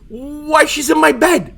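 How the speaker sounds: flustered protest. Acted. Defending himself, flustered.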